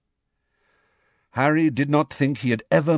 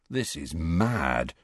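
low, slow, soft murmur; 6 semitones lower, 7 dB quieter, slower than usual. A narrator's reading. Ron on an unseen horse, murmured. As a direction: murmur it slowly under your breath. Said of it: "Ron murmured".

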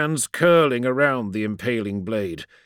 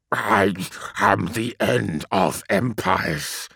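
slow, otherworldly demon voice; 4 semitones lower, slower than usual. A narrator's reading. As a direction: let each word hang, inhuman. Demon speaks into Ra's mind.

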